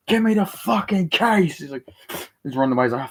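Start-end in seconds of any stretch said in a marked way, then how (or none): 0.1-1.6 high, slow, drawn-out demand; exasperated retold yell; acted angry demand. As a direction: stretch out the order in mock fury. Acted fighter demanding the case.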